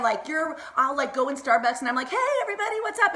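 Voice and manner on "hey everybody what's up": very high, cheery mock greeting; good-mood greeting. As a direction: imitate bursting happily into a café. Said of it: "in such a good mood".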